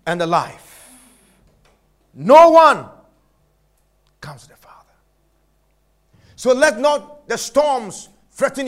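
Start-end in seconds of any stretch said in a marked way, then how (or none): 2.1-2.9 punched loud preacher call; loud emphasis. Acted. 4.2-4.9 dropped to a breathy whisper; sudden whisper. Acted. Sudden drop after loud line.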